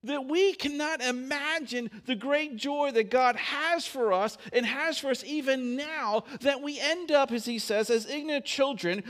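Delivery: loud, high-pitched sermon delivery; fervent preacher building the point; preaching at full force. Acted. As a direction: preach it out with fervour.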